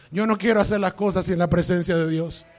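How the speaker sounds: loud, high pulpit shout; shouted preaching. Acted. Pastor quoting Moses to the church, full shout. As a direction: cry it out like a revival preacher.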